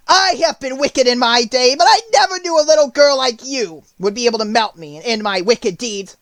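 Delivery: high, loud witch lament; melting witch ranting in despair; dying witch. A narrator's reading. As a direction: wail out a villain's last speech.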